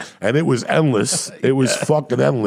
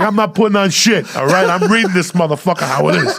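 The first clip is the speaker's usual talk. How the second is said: raised, loud mock defiance; defiant retort; 6 semitones higher, 5 dB louder than usual. Comic refusing to put his shirt on, defiant. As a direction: refuse at high volume, playing it for laughs.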